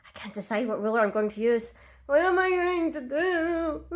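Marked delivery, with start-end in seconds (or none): 2.1-3.8 hammed-up higher, slow fake cry; mock sobbing. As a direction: wail it like a melodramatic breakdown. Despairs over choosing a ruler.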